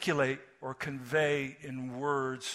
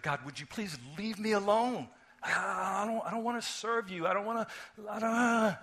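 high, whiny pleading to God, getting louder; upset man; 9 semitones higher, 5 dB louder than usual. Retold or imitated. Reluctant man begging God to leave him alone, high whiny plea. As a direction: beg to be left alone, building to a yell. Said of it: "and he was upset".

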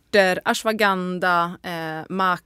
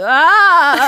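high, loud silly "ah" scream; demonstrating a silly high scream; dorky little scream; 6 semitones higher, 11 dB louder than usual. Shown as a demonstration. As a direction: let out a feeble but loud yelp of a scream. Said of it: "så var det så töntigt skrik".

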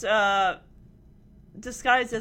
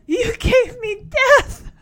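slow, loud, much higher mock drama; mock dramatic; 10 semitones higher, 8 dB louder, slower than usual. Ramona answering her mother on stage, hammed-up drama. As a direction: hurl it back theatrically. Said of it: "she adds".

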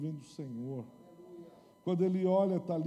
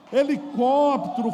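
much louder, slower mocking call; mocking shout; 9 semitones higher, 16 dB louder, slower than usual. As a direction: shout it out in scorn. Mocking fake worship.

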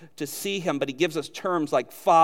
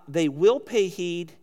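slow, with will leaned on hard; "will" stretched and pressed, each word slowed; stress on will; slower than usual. Shown as a demonstration. To the congregation, pointing out the promise: slow stress on "will". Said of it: "the wills".

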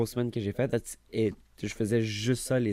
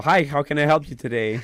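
loud, high, hammed-up service voice; mock customer-service greeting; 5 semitones higher, 8 dB louder than usual. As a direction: greet the client with exaggerated eagerness. Acted cheerful staff greeting. (accueil client exagéré, fort et aigu)

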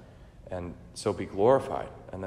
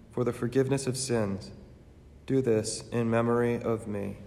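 measured, reverent altar prayer; solemn prayer; 4 semitones higher, 4 dB louder than usual. Acted. Eucharistic prayer and consecration.

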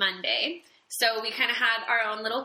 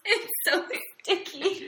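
laughing; 7 semitones higher, slower than usual. Real, not acted. Laughing at a gooey face mask.

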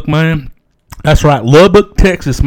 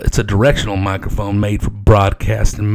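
usual speaking voice

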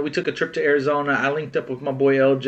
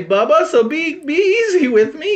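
pleading; 12 semitones higher, 4 dB louder than usual. Retold or imitated. Friend begging Bubba for a Father's Day pass, pleading. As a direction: beg for mercy, voice climbing high. Acting his plea to friend.

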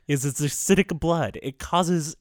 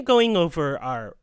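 normal talking voice

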